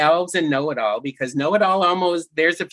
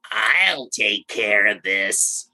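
low, slow, lightly voiced creepy elf; a hint of a sinister old elf; creepy elf impression; 7 semitones lower, much slower than usual. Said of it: "and the elf goes".

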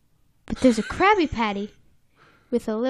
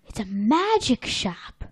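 awed gasp. Acted. SpongeBob looks up at a magic shop, awed. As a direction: gasp in mock wonder.

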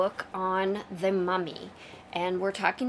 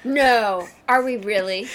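mock disbelief; 7 dB louder than usual. Co-host feigns disbelief at announcement.

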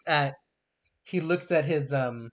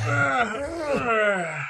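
put-on old man groan; 8 semitones higher than usual. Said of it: "he makes that old man noise".

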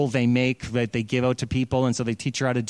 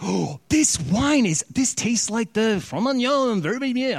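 much higher, savouring each word; effusive praise; 8 semitones higher than usual. Retold or imitated. General savouring the wine at the feast, high and effusive. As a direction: gush like a connoisseur in raptures. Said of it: "he's just effusive with his praise".

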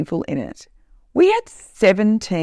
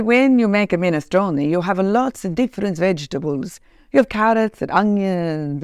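imitated Italian restaurant owner explaining; acted Italian cook's accent; 3 semitones higher than usual. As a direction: put on a lilting Italian accent. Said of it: "and I do accents".

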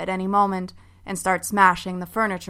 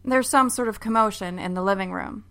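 ordinary delivery, nothing marked

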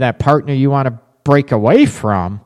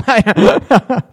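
high, real giggling burst; amused laugh; 7 semitones higher than usual. Real, not acted. Host joking she can't read Spanish, amused laugh. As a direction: laugh at your own slip, high.

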